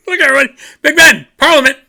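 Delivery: high, loud, frantic tourist dad stuck on a roundabout; high-pitched excited tour guide shout; Clark Griswold impression. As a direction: yell "look, everybody" like a giddy dad pointing out sights.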